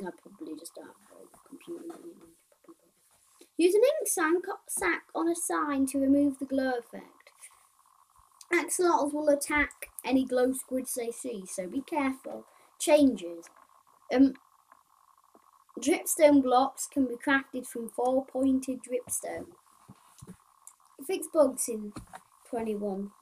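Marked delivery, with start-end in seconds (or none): 3.6-13.5 louder, higher list-reading voice; reading aloud. Acted. Player reading game update notes to viewers, reading aloud. 15.8-23.1 slow, loud, higher read-out; reading aloud. Acted. Player reading a crafting change to viewers, reading aloud.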